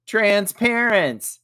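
high, loud, drawn-out announcement; slow, ringing reveal of the joke; announcing the punchline. A narrator's reading. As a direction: stretch out the punchline proudly. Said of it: "announced Ethan".

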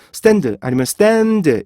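Koreanized stand, 스탠드, last one stretched; Korean accent. Shown as a demonstration. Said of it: "우리나라 사람들은요".

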